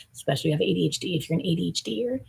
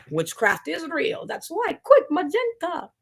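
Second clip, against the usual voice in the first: witchy exclamation; 11 semitones higher, slower than usual. Acted. Dramatic witch claim.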